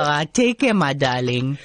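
man playing a doting Durban auntie; sweet-talking aunty character voice; Auntie Sheila voice. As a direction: male comic in a female auntie voice. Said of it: "you change into a female voice".

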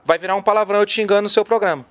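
ordinary talk

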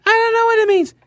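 squeaky high-pitched caricature; mock his dismissal in falsetto; Philip Bump impression. Host mocking the columnist dismissing the evidence, high squeaky impression.

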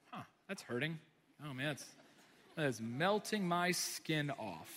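casually note a burn, in no hurry; mock calm. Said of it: "You don't, like, touch it and you're like".

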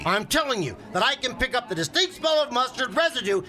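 rising indignation at his fishing partner; heated argument. Acted. Fisherman fed up with his partner, angry rant. Fishing buddies quarrel over fish.

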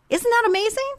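high, bright marvelling question; amazed delight. Real, not acted. Host marvelling to listeners, excited and high. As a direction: marvel at it openly.

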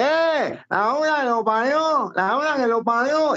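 high mock nasal voice, angry; nasal stutterer voice. Nasal trucker swearing at the tiny car. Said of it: "un gangoso".

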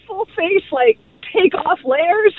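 usual speaking voice

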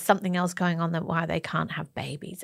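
hushed gossiping whisper; conspiratorial whisper. Retold or imitated. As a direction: whisper it like a juicy rumor. Mimicking gossip rumors.

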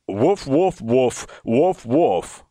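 low, mock dog barks; dog barking. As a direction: bark like a dog down the phone. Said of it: "not speaking like a dog".

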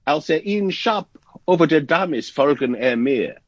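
put-on imperfect Dutch accent. Radio guest quotes a Dutch saying to the host, imperfect Dutch accent. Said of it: "with a better accent, of course".

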